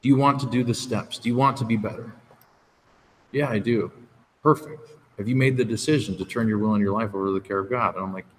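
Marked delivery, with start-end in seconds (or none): none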